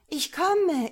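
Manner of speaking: high, loud ringing shout across the earth; ringing call. A narrator's reading. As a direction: call out like the sun over the land. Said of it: "rief mit schallender Stimme". (hoch und laut schallender Ruf)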